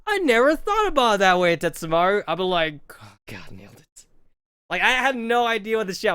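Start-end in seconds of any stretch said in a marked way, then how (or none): 0.1-2.2 higher, louder awed praise; impressed classmates. Retold or imitated. Classmates marveling at his answer, mock awe. 3.0-4.1 very soft low murmur to himself; smug aside. Retold or imitated. Congratulating himself after fooling everyone, hushed smug murmur.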